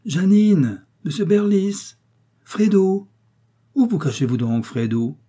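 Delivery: calling out names high and loud across the cliffs. A narrator's reading. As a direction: shout for them from a distance. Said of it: "une voix s'écria".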